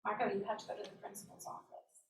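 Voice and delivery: soft, quick, low-key announcement; teacher's summons. Retold or imitated. Teacher sends child to principal's office, softly ominous. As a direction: deliver it soft and matter-of-fact.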